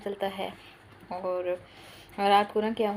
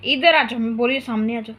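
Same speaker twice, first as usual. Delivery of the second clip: loud order; 11 dB louder, faster than usual. Real, not acted. Mother loudly ordering her son to come in front. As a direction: call him over, much louder.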